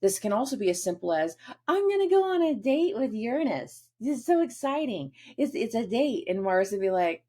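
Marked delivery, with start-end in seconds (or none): 1.7-6.2 giddy excitement. Acted. Moon voiced giddy about a date.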